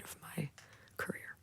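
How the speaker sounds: conspiratorial whisper. Real, not acted. Singer hinting at secret duets to the host. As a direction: hush it like a secret you shouldn't tell.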